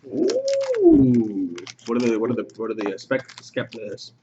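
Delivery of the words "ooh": impressed ooh. Real, not acted. Reacting to Manziel touchdown.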